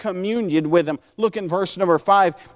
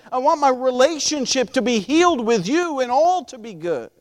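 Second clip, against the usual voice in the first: higher, urgent pleading to God; begging; 5 semitones higher than usual. Acted. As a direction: beg insistently, each plea piling on the last. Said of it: "He's begging".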